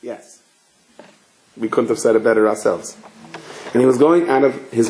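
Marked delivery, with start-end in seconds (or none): none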